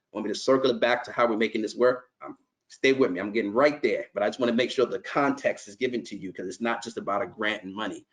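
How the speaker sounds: ordinary talk, nothing marked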